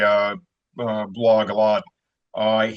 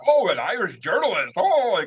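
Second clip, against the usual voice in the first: high mock surprise, the last "Oh" squeaking even higher; 6 semitones higher than usual. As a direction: imitate delighted protesters caught off guard. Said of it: "they're like".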